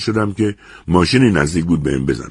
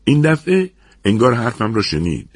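usual speaking voice

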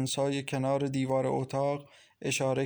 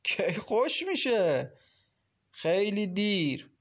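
slow, drawn-out, higher-pitched leering banter; ugly amusement; 6 semitones higher, slower than usual. A narrator's reading. Landlord and hostess trade banter about Maryam, drawn out and nasty. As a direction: stretch the words out with nasty amusement. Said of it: "ماشاءالله با خندهٔ زشتی گفت".

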